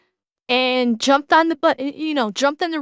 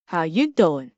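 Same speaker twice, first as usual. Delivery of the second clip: New Yorker accent; 8 semitones lower than usual. Shown as a demonstration. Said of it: "Can I pull off the New York?".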